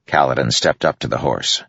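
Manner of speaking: usual speaking voice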